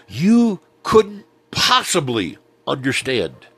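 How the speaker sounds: slow, dismissive mock of the ex-wife; scornful ex-wife. Imitating his ex-wife dismissing him, slow and scornful. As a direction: drag it out with a condescending sneer. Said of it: "she would huff, snort, roll her eyes, and go".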